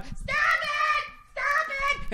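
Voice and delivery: shrill falsetto mock scream of stop it; hammed-up high-pitched screaming lady; screaming woman. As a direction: shriek it way up high like a squeaky toy. Mocking screaming lady again.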